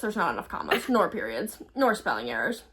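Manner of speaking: low, stern grammar critic; Sam correcting grammar. Retold or imitated. Host imagining Sam's reply to a text, low critical voice. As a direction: drop the pitch and list each fault. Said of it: "She'd go".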